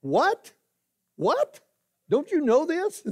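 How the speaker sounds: rising What? What? in mock disbelief; shocked high-pitched challenge; voice goes up. Shown as a demonstration. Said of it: "the voice goes up, but, uh, in mine does".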